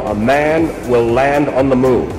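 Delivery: usual speaking voice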